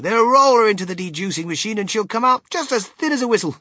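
high, loud, animated showman; Willy Wonka, breezy. Acted. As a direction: rattle it off with theatrical confidence.

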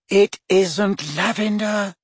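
high, slow, drawn-out impatience; impatient. A narrator's reading. Girl corrects her hiding friend, impatiently. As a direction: spell it out wearily for him. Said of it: "said Hermione impatiently".

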